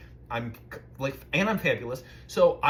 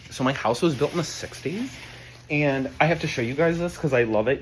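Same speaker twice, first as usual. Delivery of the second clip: ordinary delivery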